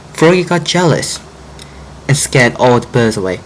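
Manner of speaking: plain reading voice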